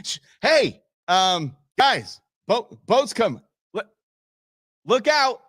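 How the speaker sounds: high, halting mock warning; imitated hesitant warning. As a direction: fumble for words, mimicking a late warning. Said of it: "He said".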